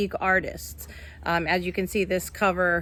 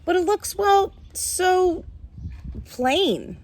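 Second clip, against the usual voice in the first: lively, higher storybook read-aloud; reading to children; 4 semitones higher, 4 dB louder, slower than usual. A narrator's reading. Teacher reading a picture book to her class, lively storytelling.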